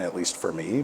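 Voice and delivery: ordinary talk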